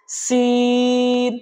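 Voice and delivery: সিন chanted with a long level vowel; elongated letter. Shown as a demonstration. Teacher asks students to repeat, সিন drawn out. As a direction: stretch the middle of সিন on one note. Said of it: "আমরা তিন আলিফ টানবো".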